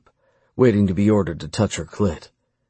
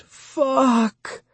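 very high, drawn-out cry; a restrained scream pitched way up. A narrator's reading. Lover overcome as he watches, high restrained scream. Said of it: "she screamed".

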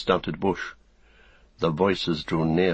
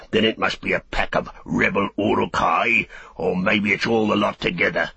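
orc soldier; 3 dB louder than usual. Acted.

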